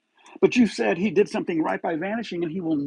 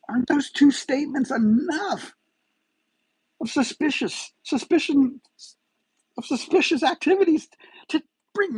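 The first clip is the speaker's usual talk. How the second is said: stumbling over words in real frustration; getting upset; 11 semitones higher than usual. Real, not acted. Vlogger to police, upset and pleading about suspicious statements. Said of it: "I'm getting upset over this again".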